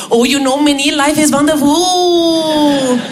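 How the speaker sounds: slow, blissful Indian English; Indian accent. Retold or imitated. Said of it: "Raj, un Indien". (Raj avec un accent indien, béat et lent)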